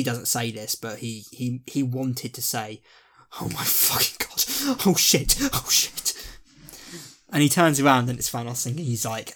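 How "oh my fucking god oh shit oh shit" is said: high, breath-only panicked muttering; mock panic. Hero realises Thanos is behind him, mock panic. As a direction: squeak it out slowly in mock dread.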